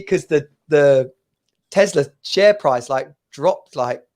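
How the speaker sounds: normal talking voice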